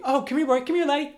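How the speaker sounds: very high, cooing Scottish genie. Retold or imitated. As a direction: coo at the dog like the genie. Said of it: "it's like".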